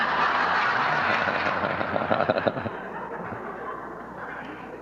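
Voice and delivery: long quiet breathy laughter; a delighted, unvoiced laugh; gentle drawn-out laughter; long soft laugh. Real, not acted. After the Zen death story.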